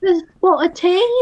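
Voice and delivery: a silly British tease; mock British accent. Pushing the joke on in a mock British accent.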